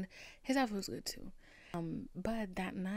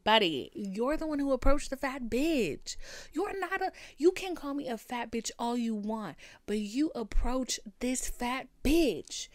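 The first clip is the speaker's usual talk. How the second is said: raised, higher-pitched retold comeback; indignant retort; 4 semitones higher, 4 dB louder than usual. Answering the friend who insulted her, heated indignant retort. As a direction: throw the insult back at him, emphatically.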